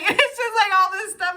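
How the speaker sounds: high, loud laughing burst; real laugh breaking into words. Real, not acted. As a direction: laugh it out mid-sentence. Laughing mid-rant.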